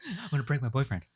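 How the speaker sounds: soft, fast joking voice; quick soft mock TikTok voice; mock TikToker. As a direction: rattle off the parody lines.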